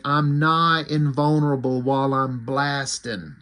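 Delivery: put-on drawn-out Southern accent; Southern drawl. Comic reviewer quoting Cannonball's catchphrase, slow Southern drawl. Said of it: "in his southern drawl".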